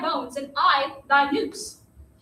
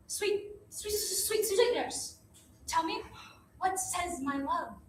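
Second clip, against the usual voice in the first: soft, pleading, wheedling; coaxing softly; 11 dB quieter, slower than usual. Acted. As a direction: sweet-talk the nurse gently. Juliet coaxing nurse softly.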